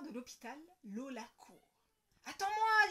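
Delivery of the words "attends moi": loud, high call to wait; dad calling down the corridor; calling after her. Acted. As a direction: call out to the running girl. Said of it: "dit papa".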